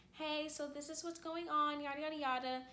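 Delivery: mildly panicked mock mom voice; mom's panic voice. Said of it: "my mom is talking in a panic voice".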